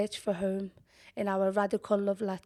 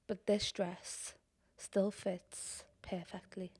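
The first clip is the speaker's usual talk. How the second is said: much softer, slowed right down; slow near whisper; 2 semitones lower, 9 dB quieter, slower than usual. Acted. Poet admitting the dress still fits, hushed. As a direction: let it fade to almost nothing. Poem line trailing off.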